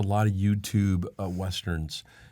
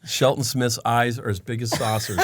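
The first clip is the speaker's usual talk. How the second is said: usual speaking voice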